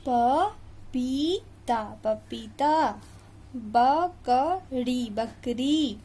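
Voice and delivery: each word drawn out slowly; slow careful reading. Shown as a demonstration. Teacher to class, reading each word slowly. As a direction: read slowly for children to repeat. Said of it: "teacher reads words slowly".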